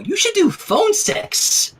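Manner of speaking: squealing up high and slow; Pinky gushes in a high, drawn-out squeal; amazed. A narrator's reading. Pinky gushing to Fluttershy, high amazed squeal.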